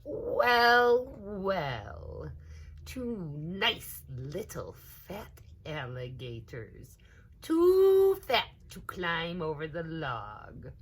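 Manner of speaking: slow, sly predator voice, rising loud; greedy alligator, slow and oily; sly big alligator. A narrator's reading. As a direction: tease the little ones like a hungry beast. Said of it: "said the big hungry alligator".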